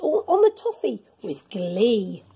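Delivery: usual speaking voice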